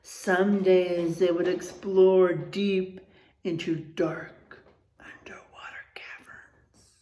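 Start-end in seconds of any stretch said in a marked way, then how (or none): 3.4-7.0 low, slow hushed whisper; spooky whisper. Acted. Teacher reading about dark caves, spooky whisper. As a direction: make the caves sound dark and creepy.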